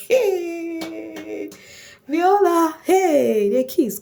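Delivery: high, drawn-out squeal of gossip; excited squeal. Real, not acted. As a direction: squeal the juicy news, high and loud. Excited gossip about a kiss.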